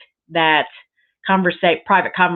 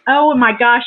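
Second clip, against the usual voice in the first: louder, brighter oh my gosh; excited outburst; 4 semitones higher, 5 dB louder than usual. Real, not acted. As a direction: burst out with delight. Opening a gift.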